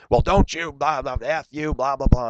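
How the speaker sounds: high mock rant, blah blah; mocking the woman.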